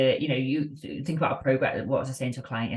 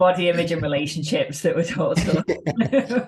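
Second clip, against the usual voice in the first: laughing through the words, a little higher and louder; 5 semitones higher, 4 dB louder than usual. Real, not acted. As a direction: chuckle your way through the gripe. Laughing while complaining about Disney.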